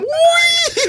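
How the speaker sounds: very high-pitched squealed shout; mock enthusiastic cheer. Imitating the one audience member who loves it, high cheer. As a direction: squeal it like the lone over-keen fan.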